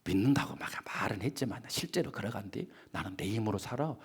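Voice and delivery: dismissive believer. Acted. Preacher mimicking a self-reliant believer, softly smug. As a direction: brush it off like someone who trusts only himself. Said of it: "옆에서 사람들이".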